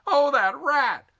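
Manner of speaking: high, loud, slow furious cry; angry outburst. A narrator's reading. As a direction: explode at the thought of Zim. Jenkins's outburst.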